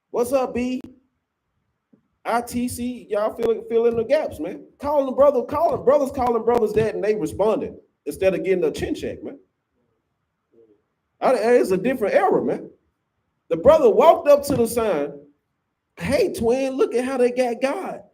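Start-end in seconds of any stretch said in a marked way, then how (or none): 0.1-0.8 mock girly greeting. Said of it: "Calling each other's B's and H's like females". 15.9-18.0 mock girly voice. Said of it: "he started talking like a, a, a woman".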